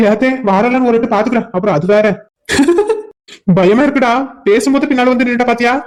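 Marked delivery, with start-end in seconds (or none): 2.5-3.0 high, rising nervous laugh; a squeaky laugh of relief. Real, not acted. Laughs after her outburst.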